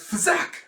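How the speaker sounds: sharp high "fzack" sound effect; imitated zap. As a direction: make the noise of a bolt hitting. Imitated zap of thrown bolt.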